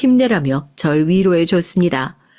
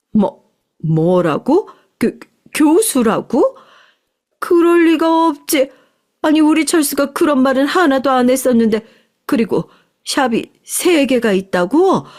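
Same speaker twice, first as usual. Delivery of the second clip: a mother-in-law thrown by the news; stunned disbelief; 6 semitones higher than usual. Acted. Mother learns her son's girlfriend is a professor, stunned.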